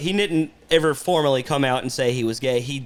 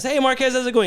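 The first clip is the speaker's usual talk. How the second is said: higher, friendly hello; bright greeting with a lifted pitch; cheery greeting; 9 semitones higher than usual. Retold or imitated. Brother greeting the worker by the wrong name, with a higher friendly hello. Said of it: "and he goes".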